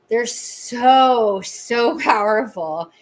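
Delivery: ordinary talk, nothing marked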